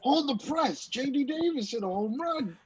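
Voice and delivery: high-pitched mock announcer; high mock excitement. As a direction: squeal it like big news. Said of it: "He was like".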